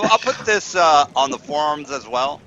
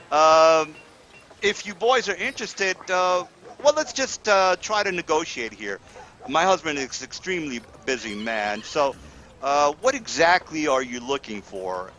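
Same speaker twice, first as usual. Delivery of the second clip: a lady's pitched-up voice, sweet and persuasive; wife's voice; 3 semitones higher than usual. Acted. Game master voicing the wife to the players, a higher, coaxing lady's voice. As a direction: play the hostess coaxing the adventurers.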